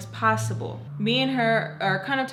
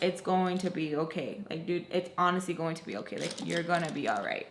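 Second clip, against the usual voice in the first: lower, softer, gentle reassurance; retold comforting; 4 semitones lower, 5 dB quieter than usual. Hugging her weeping friend, gently reassuring. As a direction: soothe a crying friend quietly.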